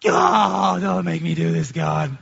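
half-cried plea, begging; mock crying plea. Preacher retelling his daily tearful prayer, mock crying. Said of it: "every day I was literally crying".

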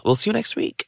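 high and soft; sing-song hushed farewell; soft goodbye. Real, not acted.

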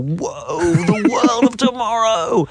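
high, slow, drawn-out wonder; mock awe. Guest parodying amazed trailer viewers, hammed-up awe. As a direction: stretch it out like a dazzled onlooker.

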